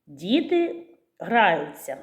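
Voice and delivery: slow, clear example sentence; slow demo sentence. Shown as a demonstration. Teacher slowly modelling an example sentence for kids. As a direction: spell it out slowly for the children. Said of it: "Наприклад".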